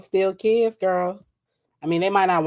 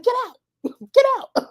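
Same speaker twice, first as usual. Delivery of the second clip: high, loud yell broken by a laugh; laughing mock yell at Nika; mock old lady yell; 4 dB louder than usual. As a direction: shriek it while cracking up. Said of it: "you just be like".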